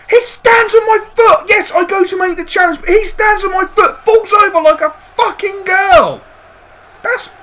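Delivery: high, loud outraged protest; furious shout. Real, not acted. Manager protesting a booking to the referee, furious shout. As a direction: vent real outrage.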